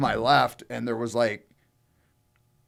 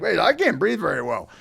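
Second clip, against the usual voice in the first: higher-pitched mock alarm; retold sudden realization; 6 semitones higher than usual. Drinker suddenly noticing trouble to friends, retold sudden realization. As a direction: imitate catching yourself mid-brush-off, pitched up.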